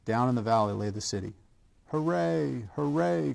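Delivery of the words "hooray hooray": high, drawn cheer at moderate volume; cried hooray. A narrator's reading. Clown and toys reach the mountain top, high cheer. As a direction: cheer it out, pitched well up. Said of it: "cried the funny little clown".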